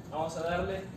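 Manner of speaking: ordinary talk, nothing marked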